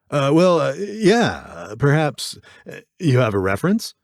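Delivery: stall, then venture the question; hesitant dealer. A narrator's reading. Dealer unsure whether to agree.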